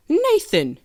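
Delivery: high-voiced British mum calling her child; hammed-up British mum; British accent. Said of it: "pioneers had British accents in that scene".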